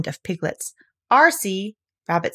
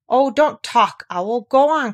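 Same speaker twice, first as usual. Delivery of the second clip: louder, hurry him along; impatient urging; 5 semitones higher, 6 dB louder, slower than usual. A narrator's reading. Christopher Robin hurrying Owl away, louder and urgent. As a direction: push the words out fast.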